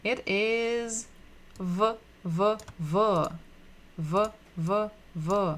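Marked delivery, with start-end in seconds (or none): 1.6-5.5 "V" as a short voiced v sound, repeated; isolated letter sound. Shown as a demonstration. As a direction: demonstrate "V" as a sound, vuh, not the letter name. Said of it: "What sound does it make?".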